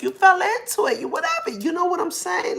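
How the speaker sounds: squeaky high mock of the sister. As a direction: mimic her sister in a shrill teasing voice. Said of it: "she said this and kinda like".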